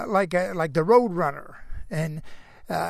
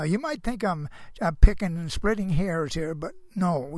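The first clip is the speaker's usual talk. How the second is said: normal talking voice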